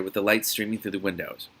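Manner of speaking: plain reading voice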